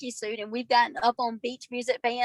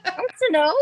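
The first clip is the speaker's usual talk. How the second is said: Southern take on Minnesota; 5 semitones higher, 5 dB louder than usual. Shown as a demonstration. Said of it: "You pronounce it too clo-, too nicely".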